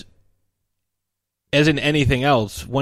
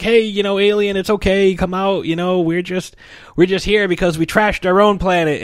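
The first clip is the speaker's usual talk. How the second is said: put-on higher, sweet coaxing kid voice; coaxing the alien; 8 semitones higher, 3 dB louder than usual. Calvin coaxing the hiding alien, high sweet voice. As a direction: lure a scared creature out gently. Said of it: "he's trying to make peace with".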